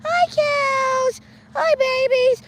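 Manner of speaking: sweet high hello to the cute cows. Real, not acted. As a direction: coo at the cows like they're babies. Greeting cute cows on the road.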